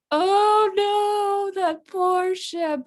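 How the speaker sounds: high, slow, wailing over the ship; dramatic Miranda. Retold or imitated. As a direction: overdo the distressed girl. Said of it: "and she's like".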